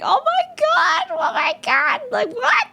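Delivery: high, disbelieving oh my god; groaning at herself in shock; embarrassed disbelief. Retold or imitated. Fufu realising her mishearing, in high disbelief. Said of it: "face palmed and she was like".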